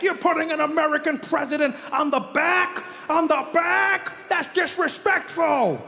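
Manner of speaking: high, incredulous outrage; mock indignation. Comic voicing offended Americans, high and indignant. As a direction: act scandalized, pitch it high. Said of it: "outraged Americans quoted".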